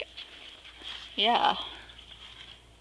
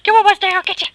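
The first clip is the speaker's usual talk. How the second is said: high, loud mock chase cry; excited bird voice; 9 semitones higher, 6 dB louder, faster than usual. The pet store bird charging at Mustang, high and loud. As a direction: squawk it out like a bird on the attack.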